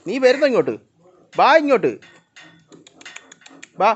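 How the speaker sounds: high, loud call across the yard. Real, not acted. Owner calling the pets over for fish, loud and high. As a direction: call the animals over loudly.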